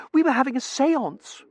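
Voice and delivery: very high, loud daughter voice; high-pitched, agitated girl; excitable daughter. A narrator's reading. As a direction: explain it to Papa in a high pitch. Daughter explaining to father.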